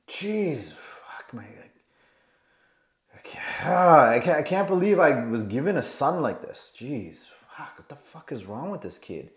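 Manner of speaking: hushed, exasperated grumbling to himself; acted frustration, sinking to a near whisper; frustrated dad. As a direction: sigh it out under your breath in disbelief.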